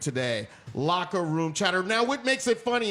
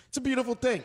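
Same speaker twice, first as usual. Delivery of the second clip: ordinary talk, nothing marked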